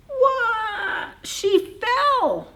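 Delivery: sudden high cry as she drops; startled cry. A narrator's reading. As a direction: yelp in surprise mid-word. Bird falls from branch.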